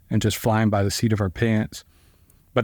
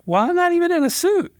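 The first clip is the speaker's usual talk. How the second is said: squeaky falsetto complaint; high panicked whine. Retold or imitated. Lawyer whining he has no suit for trial, squeaky falsetto. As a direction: play it as a flustered, much higher voice. Panicked, not dressed for court.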